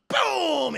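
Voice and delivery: high, drawn-out boom; imitated gun boom. Imitating her giant gun firing, booming. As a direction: let the boom burst out high.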